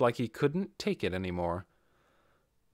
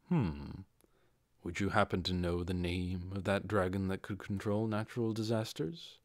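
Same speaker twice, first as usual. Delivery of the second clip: low, much softer hesitant mumble; mumbled; 3 semitones lower, 5 dB quieter than usual. Acted. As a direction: ask half under your breath, unsure of your welcome. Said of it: "Ainz mumbled".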